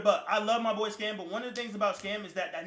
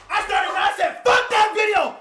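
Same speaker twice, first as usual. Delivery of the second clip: screaming, much higher and louder; furious yell; 13 semitones higher, 11 dB louder than usual. Retold or imitated. Demanding his stolen money from the crew, screaming. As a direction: scream it at everyone in the house. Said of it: "Chat, and I'm this loud".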